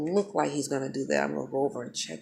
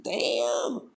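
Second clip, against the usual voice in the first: impressed mock wince; 8 semitones higher than usual. Reacts to seeing him get hit.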